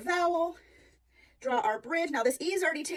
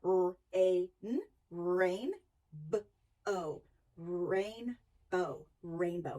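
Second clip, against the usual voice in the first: slow, lower sound-it-out blending; 6 semitones lower, 4 dB quieter, much slower than usual. Shown as a demonstration. Teacher modelling sounding out a word, slow and careful. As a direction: stretch each chunk, then blend it into a word. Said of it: "we sound it out".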